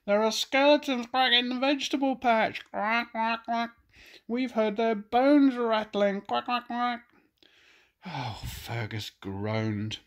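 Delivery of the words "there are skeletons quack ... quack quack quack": high, loud quacky duck voice; panicked quacking duck. Acted. Ducks warning of skeletons in the patch, high quacking panic. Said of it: "they quacked".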